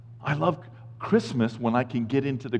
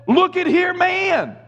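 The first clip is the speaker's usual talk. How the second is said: much higher, pushy buddy call; casual angel; 4 dB louder, slower than usual. Retold or imitated. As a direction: grab his attention like a friend. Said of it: "The angel of the Lord said".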